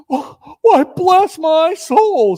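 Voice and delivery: high, drawn-out astonishment; marvel, very slowly; surprised Fred. A narrator's reading. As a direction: nephew startled at the door. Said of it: "said Fred".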